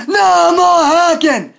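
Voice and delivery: high, slow, drawn-out shout; furious yell. Acted. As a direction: yell the demand with full outrage. Same demand, shouted then subdued.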